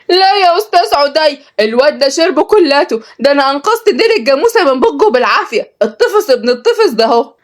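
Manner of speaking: put-on high-pitched child's complaint; indignant little girl. Girl tells teacher her brother drank the milk, high and indignant.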